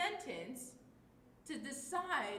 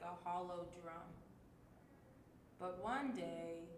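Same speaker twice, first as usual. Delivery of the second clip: sad and hopeless; 3 semitones lower, 4 dB quieter than usual. Shown as a demonstration. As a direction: read it like all hope is gone. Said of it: "with a very sad and hopeless voice".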